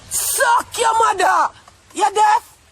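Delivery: real furious outburst, loud and high; angry shout. Real, not acted. Ranting back at viewers who annoy her, angry shouting. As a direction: yell the insult, drawn out, then challenge them.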